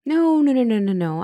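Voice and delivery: flustered denial. Acted. Counselor denying she's having stroke.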